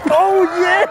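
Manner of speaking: loud, high oh yeah; excited cheer. Real, not acted. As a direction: whoop it with joy. Cheering the contestant.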